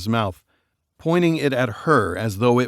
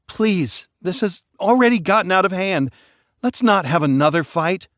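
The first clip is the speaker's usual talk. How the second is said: higher, urgent plea to calm down; pleading plea; 8 semitones higher than usual. Acted. Daughter pleading with arguing parents, urgent. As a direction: try to stop the fight.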